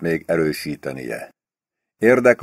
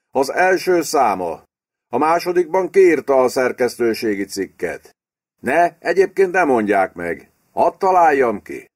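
higher-pitched character voice for an eager guesser; lightly voiced prime minister; 7 semitones higher than usual. Prime minister guessing at the journal's editorial, lightly voiced.

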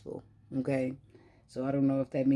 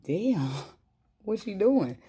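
higher and louder, slow, laughing in shock; laughing disbelief; 7 semitones higher, 4 dB louder, slower than usual. Retold or imitated. Reader reacting to the woman hitting the wall, laughing in disbelief. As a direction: gasp it out, amused and stunned. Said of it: "I was like".

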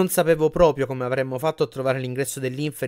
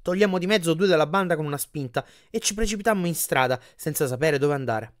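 plain reading voice

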